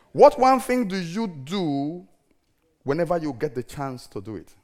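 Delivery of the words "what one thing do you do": slow emphatic. Shown as a demonstration. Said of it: "slow emphatic repeat".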